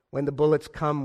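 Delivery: usual speaking voice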